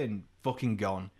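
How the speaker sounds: ordinary talk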